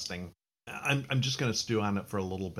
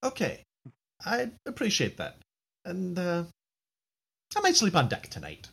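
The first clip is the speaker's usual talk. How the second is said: higher, nervous captain voice; 13 semitones higher, 7 dB louder than usual. Acted. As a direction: play the captain, uneasy. Captain talks to Dugald.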